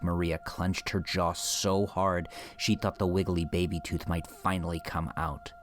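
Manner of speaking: usual speaking voice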